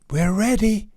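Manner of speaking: excited shout up the nose. Acted. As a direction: call it out bravely. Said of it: "Then shouting".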